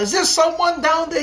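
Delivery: high-pitched call down a well; shouted call. A narrator's reading. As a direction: call out to someone far below. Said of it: "ran to the well and shouted".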